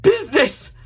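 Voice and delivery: high, loud outraged exclamation; shrieked word of protest; crying out. A narrator's reading. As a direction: explode in ghostly indignation. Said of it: "cried the ghost".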